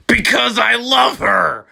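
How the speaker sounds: a very high, loud mock shriek. Draco defending his love before the teachers, hammed-up shriek. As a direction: squeal it like a lovesick teen. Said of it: "Draco shrieked".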